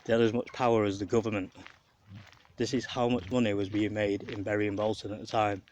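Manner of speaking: usual speaking voice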